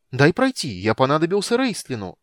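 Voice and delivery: high, loud, ringing kender voice; Tasslehoff. A narrator's reading. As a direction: pipe up loudly, eager to get through. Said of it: "зазвенел где-то поблизости Тассельхоф". (звонкий голос кендера, высоко и громко)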